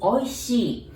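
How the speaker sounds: "Oishii" as oy-shee with a falling end; teacher models "Oishii" clearly, dropping the pitch at the end; Japanese word demo. Shown as a demonstration. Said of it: "Do you remember this too?".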